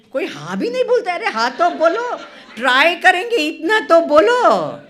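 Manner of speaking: high and loud, laughing as you prod them; playful loud teasing of the audience; loud laughing cajole. Real, not acted. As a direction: coax a silent crowd into answering. Cajoling silent audience loudly.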